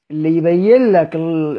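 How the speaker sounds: ordinary talk, nothing marked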